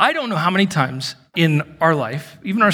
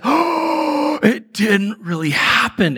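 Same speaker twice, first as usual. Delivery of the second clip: sharp gasp, high then slowing; hammed-up waking-up relief; acted gasping relief; 6 semitones higher, much slower than usual. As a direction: gasp awake and realize slowly. Acting out waking relieved from nightmare.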